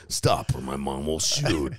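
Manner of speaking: slow, loud, dramatic title read; movie trailer voice. Pretend. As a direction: boom out the title slowly. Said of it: "can you do the movie voice".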